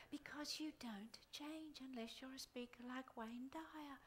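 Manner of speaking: slow, unvoiced teasing whisper; whispered aside. Real, not acted. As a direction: whisper it as a playful jab.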